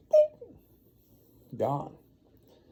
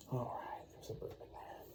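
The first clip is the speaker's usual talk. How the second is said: soft whisper; 12 dB quieter than usual. Real, not acted. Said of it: "soothing a baby".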